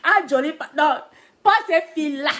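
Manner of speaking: high, shouted refusal; loud, emphatic repeated objection; retold loud objection. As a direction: re-enact the protest at full voice. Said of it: "J'ai dit, eh, eh".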